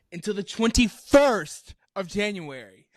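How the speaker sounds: "twenty-first" drawn out, high and emphatic; slow down and punch "twenty-first"; stress on twenty-first. Real, not acted. Friend reveals how long he went silent, "twenty-first" stretched in disbelief.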